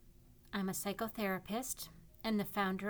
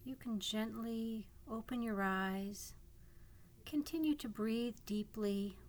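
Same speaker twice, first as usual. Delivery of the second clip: calm guiding voice with long pauses; guided meditation. Acted. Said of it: "This is a guided imagery meditation".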